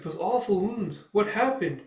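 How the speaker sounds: high-pitched worried question; concerned girl. Retold or imitated. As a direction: fret over his injuries. Girl worries over his wounds.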